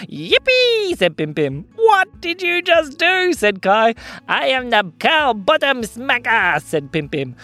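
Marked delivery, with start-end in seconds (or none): 0.1-1.0 high-pitched whoop; gleeful cheer. Acted. Pim Pim celebrates smacking the cow, gleeful. 1.8-3.3 high, loud, drawn-out astonishment; shocked disbelief. Acted. Kai questions Pim Pim's cow smack, shocked. 4.3-6.6 slow, higher-pitched proud announcement; boastful mischief-maker. Acted. Pim Pim answers Kai, slow and boastful.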